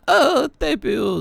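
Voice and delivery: high, slow anguished cry; a loud, high, drawn-out cry of grief. Acted. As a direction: wail the name in grief. Character cries out in game scene.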